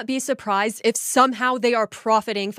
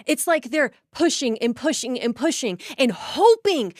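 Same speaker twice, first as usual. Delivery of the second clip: normal talking voice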